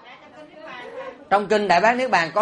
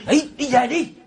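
shooing away; 5 semitones higher than usual. Retold or imitated. Helper shooing mourners out.

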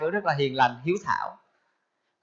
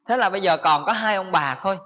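usual speaking voice

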